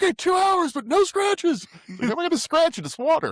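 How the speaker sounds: high, squeaky, hammed-up pleading; squeaky nervous guy. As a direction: whine it out in a high comic squeak. Voicing the boat owner.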